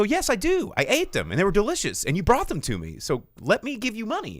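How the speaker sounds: loud, high protest; loud insisting. Retold or imitated. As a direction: push back loudly and eagerly. Insisting to pay.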